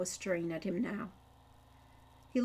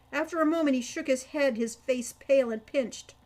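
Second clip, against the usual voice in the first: usual speaking voice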